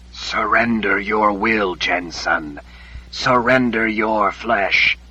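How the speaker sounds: very slow, drawn-out otherworldly command; eerie voice. A narrator's reading. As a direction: stretch it out like a haunting voice in the head. Voice in her head commands.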